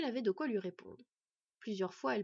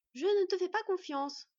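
put-on higher, louder teenage challenge; brusque; 9 semitones higher, 5 dB louder than usual. A narrator's reading. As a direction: throw it bluntly at the wolf. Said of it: "lança brutalement l'adolescent".

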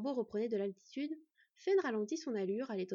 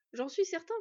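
assured, raised and firm reply; confident; 7 semitones higher, 4 dB louder than usual. A narrator's reading. Young man reassuring a worried girl, confident. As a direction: answer with confidence, pitched up. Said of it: "confirma Bord avec assurance".

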